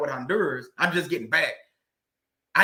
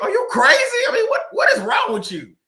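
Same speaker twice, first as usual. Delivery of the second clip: much higher, louder incredulous yell; retold outburst; 6 dB louder than usual. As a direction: shout it like you can't believe her. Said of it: "I'm sitting there like".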